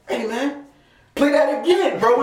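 high-pitched, insistent replay plea; retold insistent request. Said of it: "then I was like".

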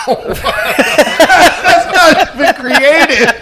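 high laugh through the words; cracking up at the joke. Real, not acted.

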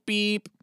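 high mouthed censor beep. Pretend. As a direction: bleep the word out with your voice. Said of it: "I'll just go".

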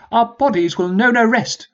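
a high, formal commanding officer; rally the troops like an old sergeant; sergeant's voice. Acted. Peabody's speech voiced high.

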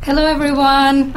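normal talking voice

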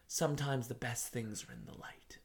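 lightly voiced cryptic British sage; soothing old British narrator. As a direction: murmur it like a wise old narrator. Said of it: "old man British voice".

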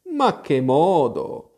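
slow, irritated exclamation. A narrator's reading. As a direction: exclaim in drawn-out annoyance. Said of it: "esclamò il topo molto irritato". (esclamazione molto irritata)

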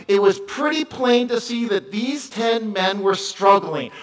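ordinary talk, nothing marked

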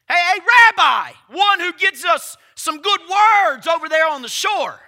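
loud calling out to the teacher, a hammed-up hail; mock shouted call. Preacher voicing a disciple hailing the rabbi, loud mock call. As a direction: shout it across the boat like a disciple hailing Jesus.

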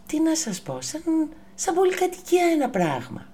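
high, fast and amazed. A narrator's reading. Telling children the giraffe is tall as a building, high and amazed. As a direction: wow the kids with how huge it is. Exaggerates giraffe height to kids.

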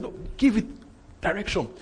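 ordinary talk

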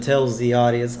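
ordinary delivery

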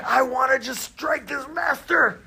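high, strained cry of fury; boiling anger. Acted. Samurai wanting to strike the master, boiling angry. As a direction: let the rage strain the voice high. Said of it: "he gets more and more angry".

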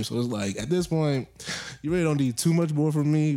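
usual speaking voice

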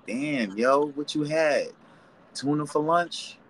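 high, rising incredulous questions; joking hint. Acted. Friend hinting to someone that they stink, high joking questions. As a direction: pitch it up like teasing someone gently.

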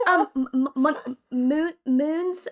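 halting false starts on "mon-, moon, moons"; stumbling over name. Retold or imitated. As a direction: sound out "moon, moons" as if lost. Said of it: "they couldn't pronounce it".

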